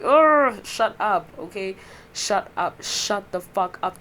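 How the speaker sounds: loud groan into an irritated brush-off; exasperated retort. Acted. Snapping back at people who correct their speech, exasperated. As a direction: start high and loud, then slow down for emphasis.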